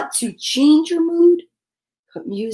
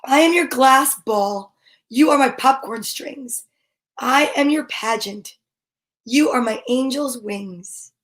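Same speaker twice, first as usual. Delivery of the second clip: gentle read-aloud of a rhyming picture book; storybook reading. Acted. Parent reading a bedtime rhyme to a child, gentle and unhurried.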